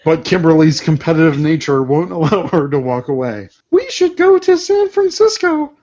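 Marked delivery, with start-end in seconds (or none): 3.7-5.7 put-on high girl voice. Read voices Kimberly line.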